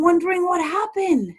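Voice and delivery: ordinary talk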